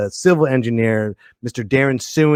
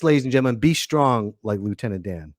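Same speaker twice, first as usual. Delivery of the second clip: ordinary delivery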